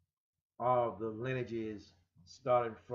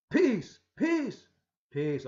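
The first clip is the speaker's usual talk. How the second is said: loud, much higher commanding call; with authority; 9 semitones higher, 10 dB louder, much slower than usual. Shown as a demonstration. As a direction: proclaim it with authority. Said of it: "or with authority".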